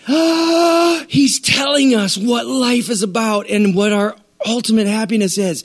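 high, awed voice of a listener who finally gets it; amazed Greek listener. Retold or imitated. Greeks hearing Jesus, high amazed voice. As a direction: let it rise high in wonder. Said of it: "they're like".

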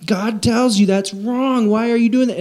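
high-pitched moralizing reproach; mock scolding. Preacher imitating believers rebuking sinners, mock scolding.

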